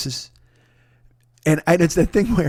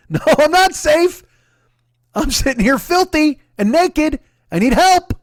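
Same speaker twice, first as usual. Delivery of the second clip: much higher, louder, slow pleading; desperate plea; 10 semitones higher, 5 dB louder than usual. Retold or imitated. Stranded naked man pleads with helper, high desperate wail. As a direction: beg for help like someone trapped. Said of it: "Catherine Martin in The Well".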